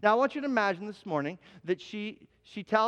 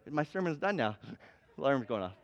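normal talking voice

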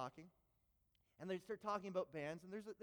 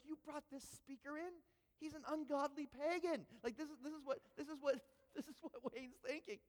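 shocked; 7 semitones higher than usual. Retold or imitated. Shocked Wayne voiced.

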